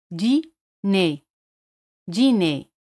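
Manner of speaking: "Dîner" ending on a plain e, no r; French dîner. Shown as a demonstration. Teacher models "Dîner" for Spanish speakers. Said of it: "E, R juntos se pronuncian".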